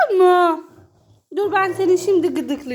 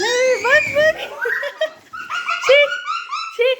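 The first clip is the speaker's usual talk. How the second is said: laughing; 9 semitones higher than usual. Real, not acted. Laughing while children play.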